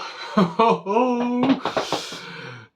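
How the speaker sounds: high, loud, drawn-out gleeful laugh; real delighted laugh rising high. Real, not acted. Gleeful over the knife's handle.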